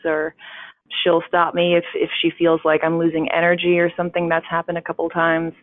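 ordinary talk

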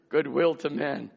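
ordinary talk

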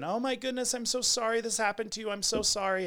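high-pitched gushing condolence; mock-sympathetic supervisor, pitched well up; imitated sympathetic supervisor. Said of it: "you're sitting there thinking".

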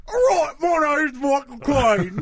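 loud, high mock Cockney Michael Caine; Michael Caine impression. Friend shows off his Michael Caine to cohosts, hammed-up shout. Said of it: "That's my Michael Caine impression".

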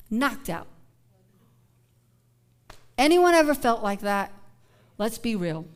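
ordinary delivery

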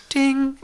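high sung-out "ding"; imitated chime. Host voicing a made-up eye wash station, imitated chime. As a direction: mimic a bright little bell, pitched high.

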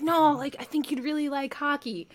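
light imitation of a pushy friend; friend's insistence. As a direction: push the idea gently. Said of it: "He's like".

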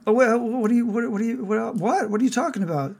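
high, stammering bewilderment; confused Harry character. Acted.

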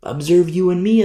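ordinary delivery

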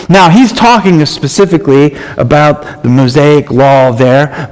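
ordinary delivery

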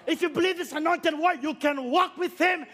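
loud, rising preacher's exhortation; preaching at full force. Real, not acted. Preacher urging the church to try, full-force preaching. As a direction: drive it home to the congregation.

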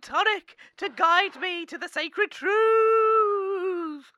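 high, loud, slow spirit-channeling wail; mystic medium voice. Pretend. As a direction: summon the spirits dramatically. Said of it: "let me consult the spirits".

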